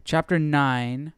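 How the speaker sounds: ordinary talk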